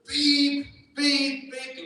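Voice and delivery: high, loud imitated horn beeps. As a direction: mimic a car horn blaring.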